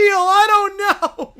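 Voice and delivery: wailing through laughter, slow; lost in helpless laughter; crying with laughter. Real, not acted. Streamer cracking up at the question.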